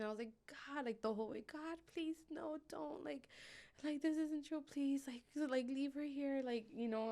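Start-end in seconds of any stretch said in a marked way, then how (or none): 0.5-6.4 high pleading, a mild mock scream; begging God on the drive; desperate prayer. Mother praying in the car, high desperate pleading. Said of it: "I was just screaming".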